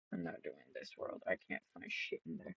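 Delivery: whispered under the breath; muttered aside. Real, not acted. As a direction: mutter it to yourself, unvoiced.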